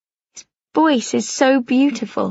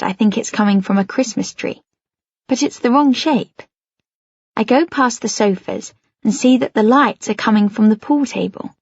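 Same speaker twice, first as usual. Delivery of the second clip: ordinary delivery, nothing marked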